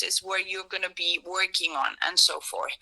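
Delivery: ordinary talk, nothing marked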